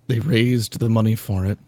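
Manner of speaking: ordinary delivery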